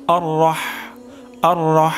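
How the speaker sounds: অর রহ with the হ breathed from the middle of the throat; correct ha. Shown as a demonstration. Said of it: "হা উচ্চারণ হবে গলার মাঝখান থেকে".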